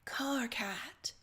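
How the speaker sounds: reverent whispered name; like a prayer. Acted. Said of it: "like it's a prayer".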